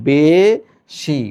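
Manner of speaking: "বেশি" very slowly as a long "beee", then "shi"; syllable by syllable. Shown as a demonstration. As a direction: stretch the closed "e" of "বেশি", pause before "shi". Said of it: "তাহলে তুমি এটাকে বলবা বে". ("বেশি" ভেঙে টেনে "বে... শি" উচ্চারণ)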